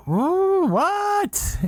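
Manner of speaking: high, squealed ooh what; hammed-up surprised squeal; mock gasp. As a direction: play their shock big. Monks react in disbelief.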